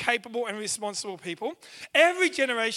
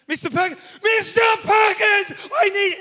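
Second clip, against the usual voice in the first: escalating shout; 9 semitones higher, 10 dB louder than usual. Retold or imitated. Student yelling for the teacher across the classroom, escalating shout. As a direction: bellow the teacher's name louder each time. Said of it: "he'd just go".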